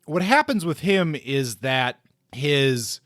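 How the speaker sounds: usual speaking voice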